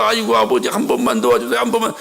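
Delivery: low mock pleading, as if grovelling; servile begging. Child begging a father for one favour, servile. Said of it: "비굴하게 막 빌면서".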